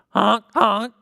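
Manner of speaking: high, loud honking goose call. A narrator's reading. Narrator honks for the geese in a high honk. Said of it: "says the geese".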